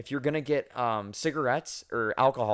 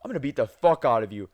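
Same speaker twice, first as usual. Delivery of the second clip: threatening; 7 dB louder than usual. Retold or imitated. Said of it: "And he's like".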